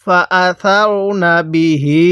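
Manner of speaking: measured chant with the last "hee" of বিহি held; slow Quran recitation. Shown as a demonstration. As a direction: recite slowly, stretching the end of বিহি. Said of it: "এক আলিফ টান দিব".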